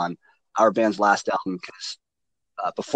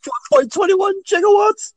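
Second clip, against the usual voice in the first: much higher, frantic mad-scientist yelp; Christopher Lloyd; 4 dB louder than usual. Retold or imitated. Riffing on the Back to the Future joke, frantic mad scientist. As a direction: squeal it like a panicked inventor. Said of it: "wouldn't it have been Christopher Lloyd".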